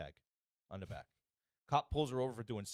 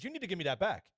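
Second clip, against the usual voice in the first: higher-pitched imitation of an irate driver; retold outraged insistence; mock insane demand; 6 semitones higher than usual. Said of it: "The lady goes insane".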